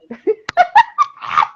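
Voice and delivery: a loud, high peal of laughter. Real, not acted. Laughing at her own explanation to a friend.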